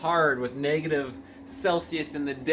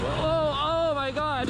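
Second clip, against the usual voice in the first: shrieking jackpot winner; 12 semitones higher, slower than usual. Real, not acted. Sliding on ice while riding.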